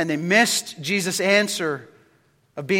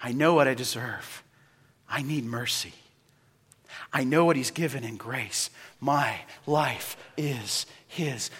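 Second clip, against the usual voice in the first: humble whisper-soft confession; 7 semitones lower, 10 dB quieter than usual. Retold or imitated. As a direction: hush it like a prayer.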